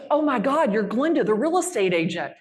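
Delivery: loud, thrilled shriek of recognition; excited recognition. Retold or imitated. Seatmate on the plane recognizing her, loud and thrilled. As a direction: blurt it out starstruck and loud. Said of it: "She goes".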